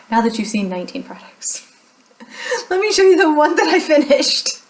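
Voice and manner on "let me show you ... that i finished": high, loud-edged laughing announcement. Real, not acted. Showing viewers her one finished product, laughing. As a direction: say it through real laughter. Laughs at own poor result.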